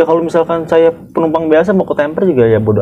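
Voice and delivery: high, firm lecture; a hint of a stern guard; lecturing guard. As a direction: set him straight. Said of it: "gitu kan".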